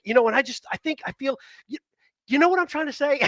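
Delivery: lightly voiced rambling trail-offs; John Ritter impression. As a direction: trail off mid-thought like Ritter. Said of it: "John Ritter would talk like that constantly to me".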